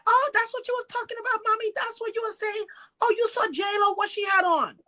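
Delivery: high-pitched imitation of a starstruck nurse; excited nurse. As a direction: gush in a high put-on voice. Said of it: "And then she said".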